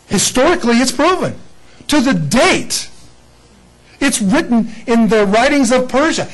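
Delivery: high, loud proclamation; rising sermon climax; preaching at full force. Real, not acted. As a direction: preach it out with fervor.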